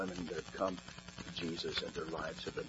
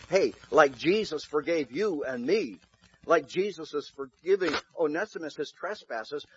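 louder, higher pulpit preaching; preaching at full force; 9 semitones higher, 11 dB louder than usual. Acted. Pastor pressing Philemon's choice on the church, full-force preaching. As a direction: drive it home to the congregation.

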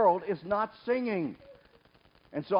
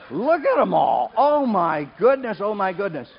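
laughing delight; 3 semitones higher, 7 dB louder than usual. Real, not acted. Preacher laughing at all the bad singers standing, amused. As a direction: chuckle at the crowd standing up.

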